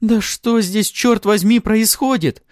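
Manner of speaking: high, loud bewildered cry; screamed. A narrator's reading. Sareeva demanding explanations, shouting. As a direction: shout the question in exasperation. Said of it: "закричала Сареева".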